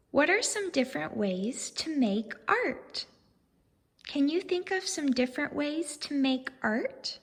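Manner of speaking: gentle questioning teacher voice; reading to children. Acted. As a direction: ask the class kindly, like at story time.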